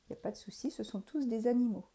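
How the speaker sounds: ordinary delivery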